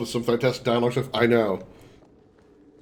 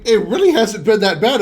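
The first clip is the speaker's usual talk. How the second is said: laughing; 7 semitones higher, 5 dB louder than usual. Real, not acted. Laughing over unhinged game dialogue.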